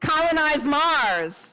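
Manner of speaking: high, slow call to the crowd; rally the room with a prompt. Shown as a demonstration. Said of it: "calling prompts to the crowd".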